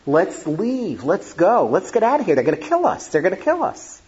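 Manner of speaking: begging. Retold or imitated. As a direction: plead with parents to flee, mounting urgency. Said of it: "I begged my parents".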